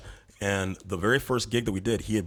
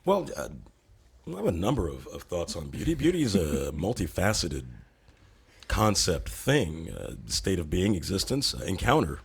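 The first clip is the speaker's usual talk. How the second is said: a lofty sage handing down wisdom, lower and unhurried; pompous guru persona; 4 semitones lower than usual. Pretend. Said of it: "I understand you have a bit on, like, beauty".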